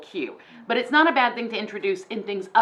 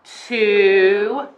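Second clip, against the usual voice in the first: kindergarten teacher voice; 7 dB louder than usual. Shown as a demonstration. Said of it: "Well, let's count together".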